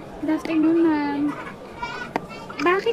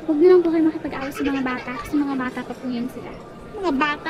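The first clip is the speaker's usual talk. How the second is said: usual speaking voice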